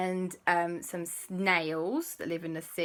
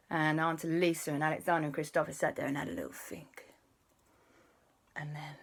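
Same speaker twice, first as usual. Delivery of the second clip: whispered narration; 3 semitones lower, 5 dB quieter, faster than usual. A narrator's reading. Narrator describes quiet thinking.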